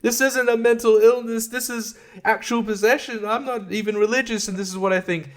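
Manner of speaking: hammed-up distraught mother; mocking Chris McNeil. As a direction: whine it like a hysterical mother in a parody. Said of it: "She's like".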